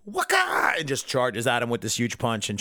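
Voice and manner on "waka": high battle yelp. Retold or imitated. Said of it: "And Vegeta's like".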